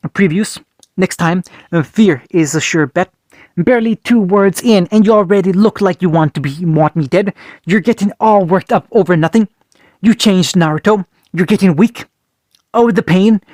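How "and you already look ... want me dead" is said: usual speaking voice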